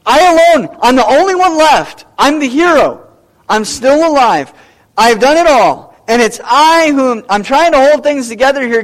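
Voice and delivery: loud boastful hero voice; self-made hero. Acted. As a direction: puff up like the only hero left, pleading with God. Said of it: "makes him look like the hero".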